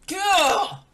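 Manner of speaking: loud, high frustrated cry of gah; frustrated shout. Retold or imitated. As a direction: let out an exasperated yell. Frustration outburst.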